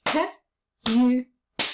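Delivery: "K", "U", "T" as separate clapped sounds; clapping the sounds. Shown as a demonstration. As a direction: break cute into its three sounds. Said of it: "Let's clap it together".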